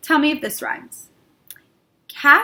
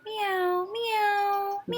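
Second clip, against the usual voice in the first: high, soft cat meows; 8 semitones higher, 12 dB quieter, much slower than usual. Shown as a demonstration. Teacher demonstrating soft high meows for the kids. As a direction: meow gently like a kitten. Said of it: "meow like a cat".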